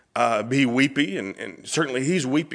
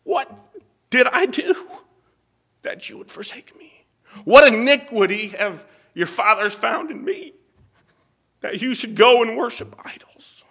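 high, tearful voice breaking into breath; anguished pleading; 10 semitones higher, 3 dB louder than usual. Acted. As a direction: plead as one forsaken, choked with tears. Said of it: "he asks the question there in verse five".

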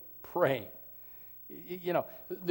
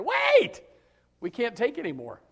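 mock shout of wait; 6 semitones higher than usual. People calling out at the tabernacle.

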